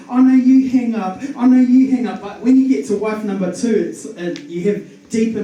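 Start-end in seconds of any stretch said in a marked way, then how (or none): 0.1-2.2 put-on teen lovers on the phone. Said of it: "you're like".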